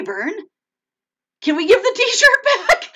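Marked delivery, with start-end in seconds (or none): 1.4-2.8 high, loud, laughing through the question. Real, not acted. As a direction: laugh while asking it. Joking plea to Vern.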